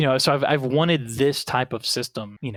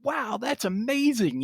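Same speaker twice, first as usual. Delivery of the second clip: higher, drawn-out wowed reaction; retold amazement; 10 semitones higher, slower than usual. New player to the priest who saved them, retold amazement. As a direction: replay your awe, slow and high-pitched. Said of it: "I'm like".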